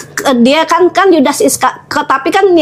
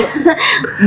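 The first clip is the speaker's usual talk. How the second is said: real laugh; 4 semitones higher, 5 dB quieter than usual. Real, not acted. Laughs at own teasing story.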